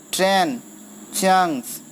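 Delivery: "Train", pause, "chunks", each drawn out and falling; word-by-word scansion. Shown as a demonstration. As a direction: give "train" and "chunks" separate beats. Teacher scanning word by word. ("Train" और "chunks" अलग-अलग खींचकर)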